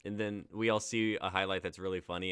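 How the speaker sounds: ordinary talk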